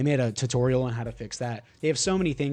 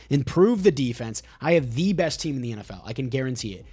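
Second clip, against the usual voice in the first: ordinary delivery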